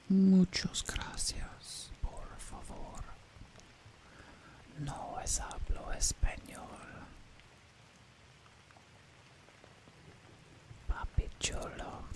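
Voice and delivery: soft breathy Spanish whisper. Pretend. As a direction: whisper it close to the mic. Whispered Spanish teasing chat.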